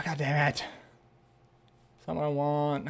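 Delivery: normal talking voice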